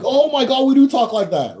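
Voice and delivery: high, loud burst of disbelief; shocked realization. Real, not acted. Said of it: "realizing, exclaims loudly".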